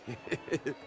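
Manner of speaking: high, laughing menace; gloating laugh. Acted. Host gloating at his guests in Italian, high laughing menace. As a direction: savour the threat through laughter.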